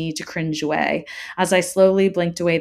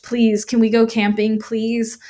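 put-on raised, insistent child's plea; choked pleading; 5 semitones higher, 5 dB louder, slower than usual. A narrator's reading. Child begging father to leave, mildly tearful plea. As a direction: beg with a touch of tears. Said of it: "I choked".

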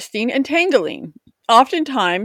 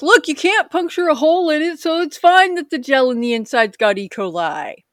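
higher, dismissive company rep; mock manufacturer voice; 5 semitones higher than usual. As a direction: brush off the danger. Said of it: "they're like".

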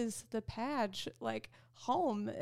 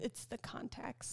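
whisper; 8 dB quieter than usual. Real, not acted. Quick aside.

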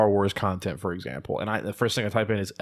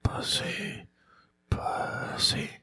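hushed teasing whisper; whispered taunt; 8 dB quieter, much slower than usual. Real, not acted. Taunting friend who won't chug.

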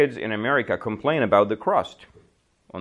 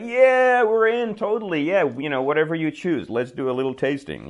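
much higher and louder, bursting with eager excitement; eager shoppers; 6 semitones higher, 4 dB louder than usual. Retold or imitated. Shoppers eagerly accepting Mike's tasting offer, excited and loud. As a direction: jump in like a crowd dying for free snacks. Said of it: "And everybody's like".

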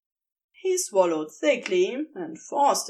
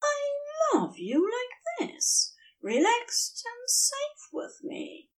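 much higher, slow and softer, near tears; tender angel; 3 dB quieter, slower than usual. A narrator's reading. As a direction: let the angel's love spill over, slowly. Angel tenderly reassures his lover.

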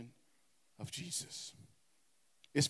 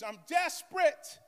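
higher and louder, leaning on desperate; rising loud declaration; 10 semitones higher, 6 dB louder than usual. Real, not acted. Preacher building his list of longings, louder. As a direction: let the word swell with need.

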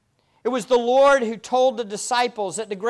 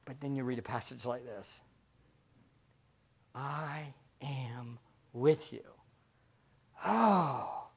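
soft and personal; 10 semitones lower, 14 dB quieter than usual. Real, not acted. Quiet personal reflection.

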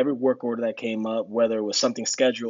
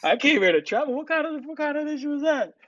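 much higher, mocking disbelief; incredulous mockery. Teasing the travel guy's excuse, high and incredulous. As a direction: throw the words back at him, amazed.

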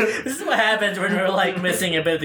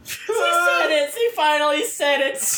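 teasing triumph; 8 semitones higher than usual. Real, not acted. Teasing friend, mock triumph.

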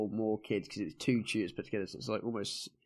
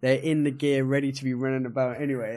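ordinary talk

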